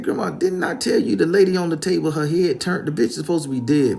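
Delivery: loud, high, agitated complaint; acted freaked-out objection; alarmed protest. Acted frightened protest to grandmother.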